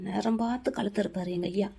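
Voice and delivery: very soft, low grumble; low, quiet irritated muttering; muttered aside. Acted. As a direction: complain under your breath. Grumbling aside about the old man.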